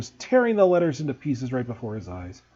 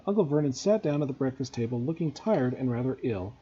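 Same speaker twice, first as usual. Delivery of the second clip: usual speaking voice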